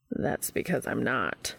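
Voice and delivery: low, slow deadpan reply; dry. A narrator's reading. Castiel correcting Dean's frat boy teasing, dryly. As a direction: drop the pitch and answer dryly. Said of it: "Castiel said dryly".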